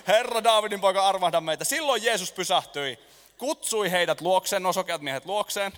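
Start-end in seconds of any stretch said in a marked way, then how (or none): 0.1-1.6 louder, insistent plea; crying out louder. Acted. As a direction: shout it again, harder. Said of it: "he huusivat vielä kovemmin".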